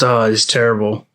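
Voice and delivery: ordinary talk, nothing marked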